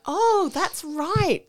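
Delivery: high, drawn-out re-enacted "oh, of course"; sudden realisation. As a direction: play remembering it all at once. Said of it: "I'm like".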